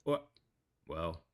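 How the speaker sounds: calm pilot reporting his own collapse; deadpan captain dying over intercom; pilot cockpit headset. Acted.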